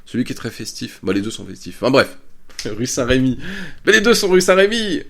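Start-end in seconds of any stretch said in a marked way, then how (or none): 3.8-5.0 high, loud burst of comic exasperation; suddenly louder and higher, amused frustration; exasperated outburst. Real, not acted. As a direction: throw it up in mock despair. Exasperated self-correction, comic outburst.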